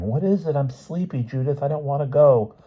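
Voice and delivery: put-on high, sleepy little-boy whimper; plaintive high-pitched child voice. A narrator's reading. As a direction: whimper it like a drowsy, reluctant child. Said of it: "she could hear Stevie whimpering".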